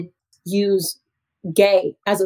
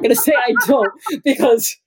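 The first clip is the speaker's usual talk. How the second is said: higher, amused admission through laughter; laughing aside; 3 semitones higher than usual. Real, not acted. Teacher joking about keeping her job, laughing as she talks. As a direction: let laughter break into the words.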